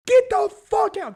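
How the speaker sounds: high, loud mock outrage; exasperated rant. Ranting at concertgoers who film, exasperated yelling. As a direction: yell it in exasperation at phone users.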